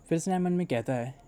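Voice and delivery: usual speaking voice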